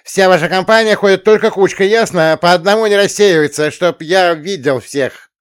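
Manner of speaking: loud high commanding counselor; bossy counselor. Acted. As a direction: drill the rule into them. Counselor orders campers.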